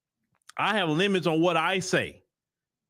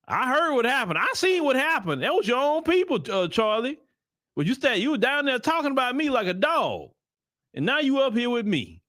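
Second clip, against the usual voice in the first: George Floyd in heaven; 6 semitones higher than usual. Retold or imitated. George Floyd greets Charlie Kirk in heaven, high mock taunting voice. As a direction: raise the voice and taunt him lightly as a ghost. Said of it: "George Floyd probably looking at him like".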